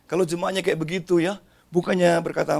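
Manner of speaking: usual speaking voice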